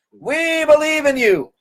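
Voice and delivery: high, slow cheering crowd chant. Retold or imitated. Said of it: "crowd answers".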